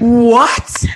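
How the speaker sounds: loud, high, incredulous burst; retold shock. Speaker hears she is called aggressive, loudly incredulous. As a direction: blurt it out in mock outrage. Retelling her shock at the accusation.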